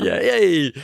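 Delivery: playful fake Italian accent; mock Italian accent. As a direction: cheer it like a hammed-up Italian. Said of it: "I can't do Italian accent".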